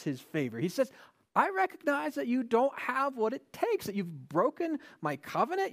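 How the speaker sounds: high, loud, impassioned sermon; a fervent, loud preacher's build; preaching at full force. Real, not acted.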